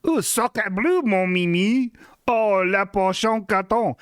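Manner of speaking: hammy fake French accent; french chef. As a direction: toss off French words like a cartoon chef. French exclamations over a French press.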